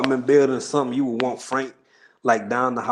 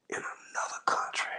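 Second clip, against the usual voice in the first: hushed aside; 11 dB quieter than usual. Real, not acted. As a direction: drop to a whisper to finish the phrase. Spells out hashtag meaning, hushed aside.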